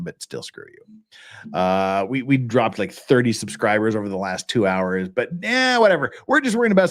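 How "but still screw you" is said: low, slow whisper at YouTube; whispered mock curse. Real, not acted. Streamer whispering a jokey curse at YouTube, slow whisper. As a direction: breathe it out like a grudging afterthought.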